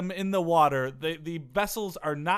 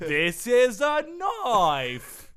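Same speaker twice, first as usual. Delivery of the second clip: very slow, higher Australian drawl; mock Australian; 6 semitones higher, 3 dB louder, much slower than usual. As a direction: deliver the knife line like Dundee. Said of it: "Crocodile Dundee quote".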